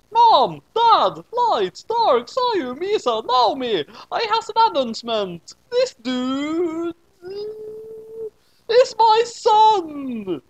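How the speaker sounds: high, loud, drawn-out proclamation; big announcement. Acted. Kid standing on the table announcing to family, high and loud.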